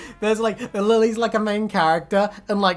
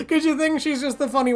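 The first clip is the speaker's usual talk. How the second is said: ordinary delivery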